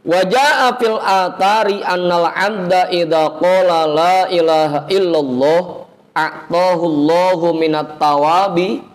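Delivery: slow, chanted Arabic recital; Arabic recitation. Real, not acted. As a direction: draw out each Arabic word like a reciter. (bacaan hadis berirama pelan)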